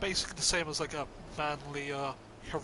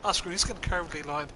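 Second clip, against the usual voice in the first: ordinary talk, nothing marked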